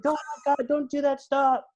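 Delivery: high-pitched mock pleading; hammed-up protest, high throughout; imitated upset girlfriend. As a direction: mimic a distressed girlfriend begging, voice pitched well up. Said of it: "And then she started crying again?".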